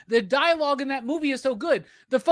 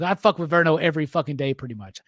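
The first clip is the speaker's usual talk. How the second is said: ordinary talk, nothing marked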